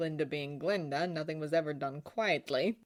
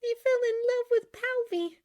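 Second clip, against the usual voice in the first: put-on high-pitched, emphatic young woman; high, heartfelt girlish retelling; girlish Glinda, upset; 7 dB louder than usual. A narrator's reading. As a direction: pour it out in a high voice. Glinda's character voice.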